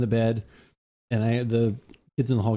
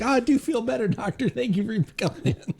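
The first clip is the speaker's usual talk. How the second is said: sick husband voice; 8 semitones higher than usual. Retold or imitated. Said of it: "He's like".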